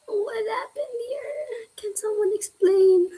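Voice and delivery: half-sob a plea for help under the breath; whimpering whisper. Real, not acted. Stuck on a physics problem, pleading into the void, whimpering whisper.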